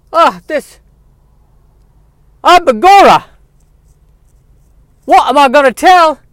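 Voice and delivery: high, loud Irish leprechaun voice; comic Irish lilt, shouting. Acted. [name] character voice, leprechaun-like.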